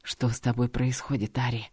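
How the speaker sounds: low hoarse wheeze; rasping whisper. Acted. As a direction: rasp it low into her ear. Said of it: "прохрипел на ухо дракон".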